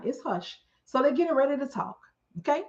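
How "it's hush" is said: softer, hushed like a choir. Shown as a demonstration. Telling the audience the room went quiet, hushed. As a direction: drop to a hush, as the choir does. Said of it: "I'm gonna do it like the choir".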